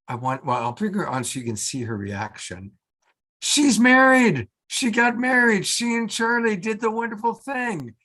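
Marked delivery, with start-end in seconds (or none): 3.4-7.9 high, elated proclamation, loudest at the start; excited announcement. Real, not acted. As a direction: burst out with thrilling news. Host announces co-host's wedding to audience.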